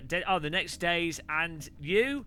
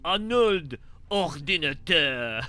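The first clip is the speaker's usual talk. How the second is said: mock action-star accent, each syllable stretched; Arnold Schwarzenegger; 2 semitones lower than usual. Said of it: "It's like Arnold Schwarzenegger".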